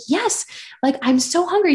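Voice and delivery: high, emphatic burst of relief; eager yes. Retold or imitated. To her boyfriend offering food, eager high yes. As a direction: jump at the offer, voice shooting up.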